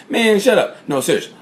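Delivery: ordinary talk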